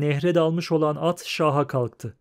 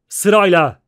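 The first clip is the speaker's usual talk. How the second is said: loud one-word command; shouting; 4 semitones higher, 9 dB louder than usual. A narrator's reading. Bandaged man ordering the crowd into line, shouting. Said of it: "diye bağırdı giderek kısılan bir sesle".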